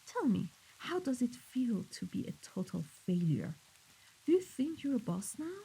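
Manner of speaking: soft, mocking interrogation; softly scornful questioning; quiet taunting. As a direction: needle the underling in a hushed voice. Boss taunts failed underling.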